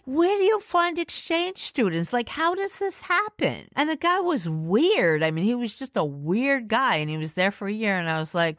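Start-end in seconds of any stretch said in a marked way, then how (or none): 0.0-3.7 incredulous high-pitched question; exasperated younger self asking; teen disbelief, pitched high; baffled. Retold or imitated.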